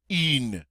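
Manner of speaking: "in" as "une" with rounded "ee"; French U. Shown as a demonstration. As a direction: pucker on the vowel of "in". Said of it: "Do you hear the difference?".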